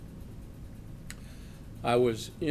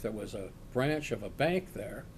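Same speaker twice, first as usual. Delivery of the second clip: usual speaking voice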